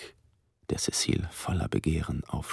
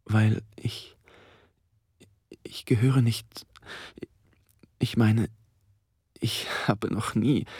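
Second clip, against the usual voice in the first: stockend, verunsichert; 5 semitones higher than usual. Acted. Stockende, aufgeregte Erklärung.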